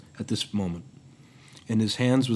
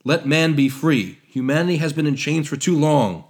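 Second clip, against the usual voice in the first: louder, forceful denunciation; vehement protest; 4 semitones higher, 6 dB louder than usual. Acted. Prometheus denouncing Hephaestus's order, vehement protest. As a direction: hammer each charge home. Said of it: "protested vehemently".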